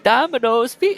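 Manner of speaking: high, loud fake Indian accent; bad Indian accent. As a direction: call it out in a badly faked Indian accent. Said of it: "it's a bad Indian accent".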